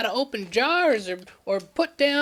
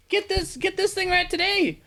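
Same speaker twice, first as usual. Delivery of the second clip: high pitched ad pitch; 7 semitones higher than usual. Acted. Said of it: "normal T V commercial".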